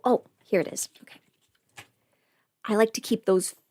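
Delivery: ordinary talk, nothing marked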